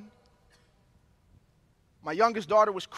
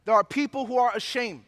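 prayer voice; 2 semitones lower than usual. Acted. Leads closing prayer.

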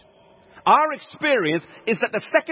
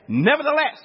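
"Nevertheless" pitched high as a strong pivot; emphatic turn; 6 semitones higher than usual. Shown as a demonstration. Preacher turning his example sentence around, "Nevertheless" high and emphatic. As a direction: lift "Nevertheless" high and hold it for weight.

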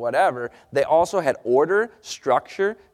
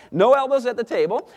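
wife's firm rule; 7 semitones higher than usual. Retold or imitated. Husband quoting wife's table rule, higher firm imitation. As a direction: lay down the rule like his wife. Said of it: "So she says".

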